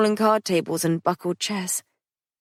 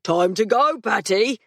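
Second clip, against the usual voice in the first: raised urgent call; John calling Patrick to leave. Acted. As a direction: shout it across the room. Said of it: "John called".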